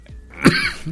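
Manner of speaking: a sharp dry cough; coughing fit. Real, not acted. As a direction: cough hard mid-sentence. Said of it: "scusate la tosse".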